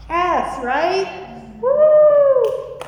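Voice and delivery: high, loud whoop of delight; joyful shout. Real, not acted. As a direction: let it burst out, whooping. Preacher's joyful exclaim to crowd.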